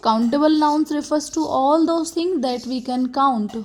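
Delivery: very slow, word-by-word dictation; dictating slowly. Shown as a demonstration. Teacher reads the definition to the class at very slow dictation pace. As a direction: spell it out so students can write it down.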